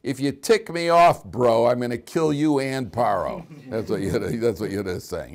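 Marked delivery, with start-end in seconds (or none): 0.0-3.5 louder, higher, slow menace; a half-playful tough-guy threat; mock threat. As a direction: warn him off, bro-style. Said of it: "he's saying".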